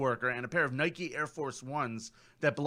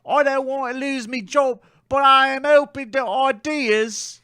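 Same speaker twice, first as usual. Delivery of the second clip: put-on higher slow New Zealand accent; 7 semitones higher, 7 dB louder than usual. Quoting post in accent.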